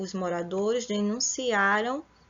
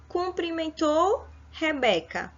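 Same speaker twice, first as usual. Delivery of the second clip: cumprimentou punched high; teacher stresses cumprimentou; emphasised correct word; 6 semitones higher than usual. Shown as a demonstration. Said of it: "O certo seria".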